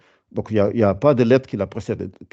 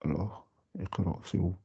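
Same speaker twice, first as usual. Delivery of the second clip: quiet aside; 2 semitones lower, 14 dB quieter than usual. Real, not acted. Searching the page, aside.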